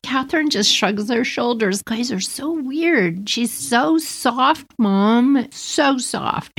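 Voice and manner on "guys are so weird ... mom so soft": high, slow, ending in a whisper; dreamy little girl. Retold or imitated. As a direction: daughter cooing to mom, high and slow. Daughter voiced, last whispered.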